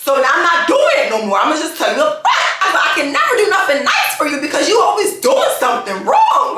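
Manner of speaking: retold rant, building to a high-pitched outburst; going off. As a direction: re-enact telling off your husband, increasingly worked up. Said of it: "I was just going off him".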